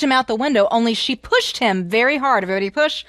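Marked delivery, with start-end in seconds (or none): none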